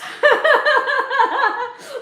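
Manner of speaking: loud, high peal of laughter; teasing laugh. Real, not acted. Host teasing a viewer about her accent, loud high laugh.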